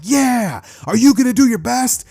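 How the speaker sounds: high, loud rallying cry; over-the-top hype. Acted. Two classmates hyping each other for the festival, overexcited. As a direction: pump up a friend, hammed-up. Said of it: "acting completely out of character".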